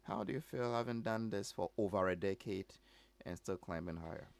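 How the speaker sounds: ordinary talk, nothing marked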